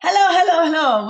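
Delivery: high, loud sing-song hello; bright hello to the whole room; calling out hello. Real, not acted. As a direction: call out to everyone joining. Greeting viewers at webinar start.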